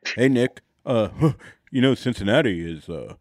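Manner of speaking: deep, laid-back dad impression; low dad voice. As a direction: drop down low like a chill dad. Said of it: "It's low. It's down here".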